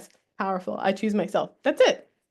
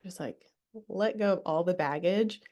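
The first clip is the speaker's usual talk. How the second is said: ordinary talk, nothing marked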